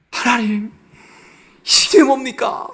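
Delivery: high, pleading cry of grievance to God; 하나님께 원망하듯; resentful complaint. Retold or imitated. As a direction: throw the complaint up at God, drawn out. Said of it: "하나님께 원망을 하는 거지".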